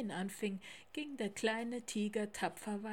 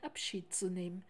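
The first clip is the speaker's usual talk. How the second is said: plain reading voice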